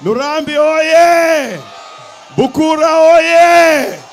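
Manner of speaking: sustained slogan cry, dropping sharply at the end; rallying crowd greeting. Real, not acted. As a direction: call out each slogan and let the crowd answer. Politician leading call-and-response greetings.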